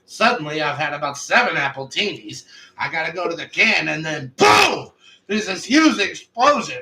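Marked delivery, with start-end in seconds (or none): 3.9-6.8 louder and slow, building the boom; slow it down and punch it out; dramatic boom. Retold or imitated. Suspect's story hits the explosion.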